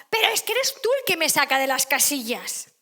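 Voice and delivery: loud, high mock yell; blaming shout. Parent snapping back at the family in a loud mock yell. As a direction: throw the blame back, loudly.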